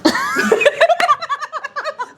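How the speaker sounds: long, high-pitched laughter; real high laugh; high giggling fit. Real, not acted. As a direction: collapse into squealing giggles. Both laugh at blushing confession.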